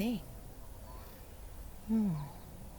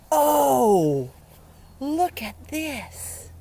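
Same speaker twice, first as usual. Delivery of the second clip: surprised; 5 semitones higher, 7 dB louder, much slower than usual. Real, not acted. New page discovered.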